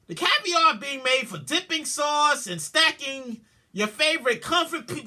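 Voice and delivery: ordinary delivery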